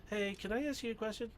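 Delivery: high-pitched voice imitating a kid; imitated kid's question. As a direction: pitch it up like a child asking. Voicing kids asking a question.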